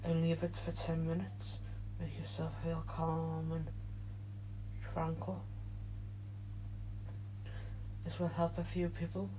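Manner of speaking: guided meditation. Acted. Leads a meditation.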